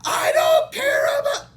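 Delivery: high, loud, slow crying scream; reenacted sobbing scream; fake scream. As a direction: scream it out through tears. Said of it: "Did you catch that in my fake scream?".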